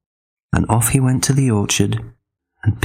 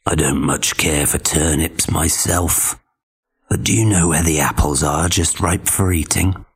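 low, sly wolf voice; coaxing wolf; 5 semitones lower than usual. Acted. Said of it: "So he said".

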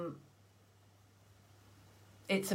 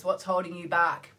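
ordinary talk

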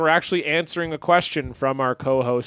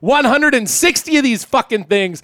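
loud, high podcast intro announcer; hype announcer; 6 semitones higher, 6 dB louder, slower than usual. Acted. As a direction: boom it out like a show host. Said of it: "do the actual real good intro".

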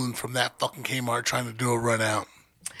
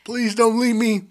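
mock pleading; 8 semitones higher, 4 dB louder than usual. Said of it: "kid begging friends not to leave".

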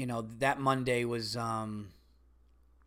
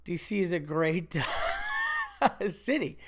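laughing mid-sentence; 3 semitones higher than usual. Real, not acted. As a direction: break up laughing before the last word.

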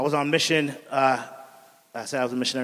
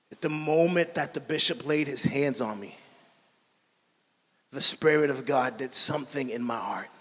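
normal talking voice

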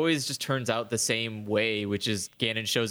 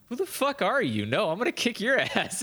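high, outraged Ganon, laughing at the end; indignant Ganon; 8 semitones higher than usual. Retold or imitated. Ganon answers the player, high and indignant. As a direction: Ganon scoffs at the challenger, laughing through it.

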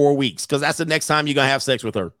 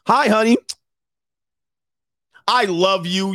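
overselling greeting; 2 semitones higher than usual. Retold or imitated. Cheating husband greeting his wife too eagerly, oversold. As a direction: pour it on a bit too sweet. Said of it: "You know you oversell".

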